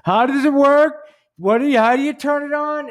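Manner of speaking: high, loud bewildered customer voice; clueless customer. Pretend. Imagined customer asking about a vibrator, hammed-up bewilderment. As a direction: play a helpless customer asking for help. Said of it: "tech talk".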